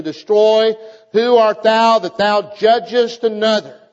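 loud, high preaching of the verses; preaching scripture. Acted. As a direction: read the scripture as a thundering sermon. Reads scripture at full force.

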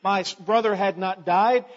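loud accusing complaint; frustrated Martha. Retold or imitated. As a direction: throw it back at him. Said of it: "She was frustrated. She said".

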